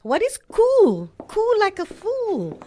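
slow, high mocking rebuke; scornful scolding. Grandmother mocks her grandson's slang, slow and scornful. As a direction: sneer the word back at him.

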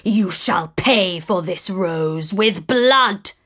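slow, menacing monster voice; raging beast. Acted. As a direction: threaten him slowly. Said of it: "raged the monster".

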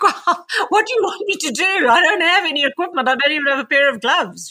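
mock-exasperated, pitched well up. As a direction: throw it back at him in disbelief. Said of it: "And I'm like".

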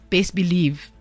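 usual speaking voice

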